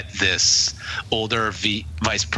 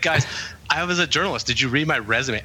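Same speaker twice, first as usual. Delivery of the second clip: a little insulting, higher-pitched retort; snotty; 6 semitones higher, faster than usual. Retold or imitated. New hire retells snapping at colleagues, a little snotty. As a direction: talk down to them a bit. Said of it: "I said it, like, that way, very, a little insulting, a little snotty".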